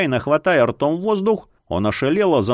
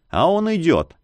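ordinary delivery

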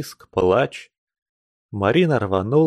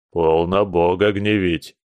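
stern; 5 semitones lower than usual. A narrator's reading. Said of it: "строго унимал Яков".

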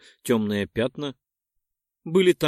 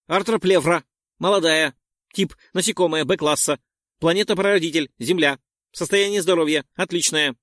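raised, brisk machine-like report; scanner readout; 4 semitones higher, 4 dB louder than usual. Acted. The psi-scanner reports on the creature, brisk robotic readout. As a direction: rattle off data like a device.

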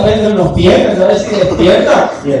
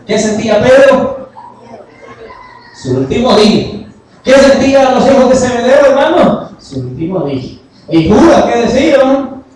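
loud, high, rousing sermon voice; raised voice, driving the point home; fervent preaching; 8 semitones higher, 7 dB louder than usual. Acted. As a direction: preach it out to the congregation. Climax of the storm sermon.

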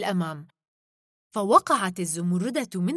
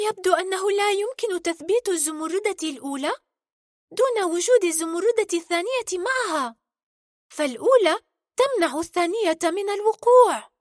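put-on high-pitched worried girl character; 9 semitones higher than usual. Merida puzzles over the loose emerald, worried. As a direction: voice Merida's concern in a raised pitch. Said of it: "نظرت ميريدا بقلق وقالت".

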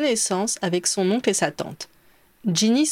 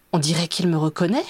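wondering question rising at the end; a touch of childlike astonishment; surprised; 2 semitones lower than usual. A narrator's reading. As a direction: ask in mild wonder, lifting the last word. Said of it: "s'étonna Teddy".

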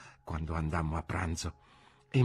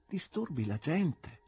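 soft, slow, higher-voiced reproach; hushed reproof; 6 semitones higher, 6 dB quieter, slower than usual. A narrator's reading. Friend warning a drunk he disturbs others, hushed.

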